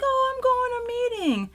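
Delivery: high, sing-song imitation of herself; mock cheery self. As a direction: chirp it like a carefree announcement. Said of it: "I'll be like".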